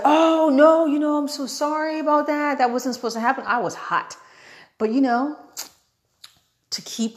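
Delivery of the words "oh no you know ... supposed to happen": apologetic worker. Retold or imitated. Register lady apologizing to upset customer, imitated apologetic worker. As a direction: imitate a gushing apology, loud at first.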